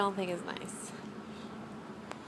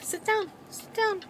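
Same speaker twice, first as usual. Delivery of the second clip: much higher singsong "sit down"; commanding the dog; 12 semitones higher than usual. Real, not acted. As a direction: coax the dog to sit in a high voice.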